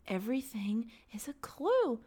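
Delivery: hushed slow whisper. Retold or imitated. Podcaster imitates herself whispering about clues, whispering. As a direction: breathe it like a detective's secret. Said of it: "I'm like".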